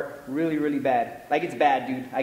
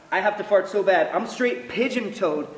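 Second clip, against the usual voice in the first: usual speaking voice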